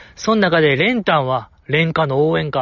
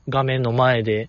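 normal talking voice

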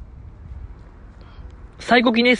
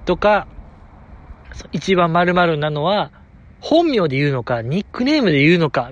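ordinary talk, nothing marked

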